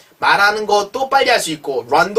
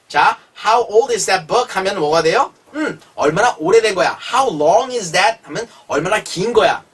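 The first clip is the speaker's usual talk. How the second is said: usual speaking voice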